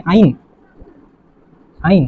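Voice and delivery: আইন said alone as "ain"; pronunciation demo. Shown as a demonstration. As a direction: model the ending আইন, pausing before the repeat. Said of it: "অ্যালকাইন উচ্চারণ কি?".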